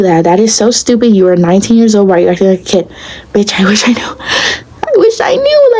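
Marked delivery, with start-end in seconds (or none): 3.3-4.2 hushed exasperated whisper; a fed-up whisper to the camera. Real, not acted. Exasperated aside to viewers. 4.8-5.8 leaps way up into a high exasperated squeak; high squeal. Real, not acted. Exasperated repeat to viewers.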